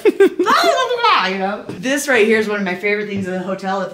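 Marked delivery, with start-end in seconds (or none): 0.0-1.3 very high, loud babbling; imitating friends babbling over him; mock squeaky chatter. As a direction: babble like a crowd ignoring him. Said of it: "They're like".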